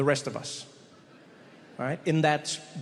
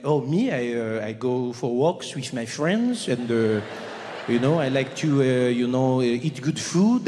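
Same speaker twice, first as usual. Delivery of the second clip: put-on louder, slow French accent with hesitations; 3 semitones lower, 4 dB louder, slower than usual. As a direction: amble through an answer in a French accent. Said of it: "go up to a French person".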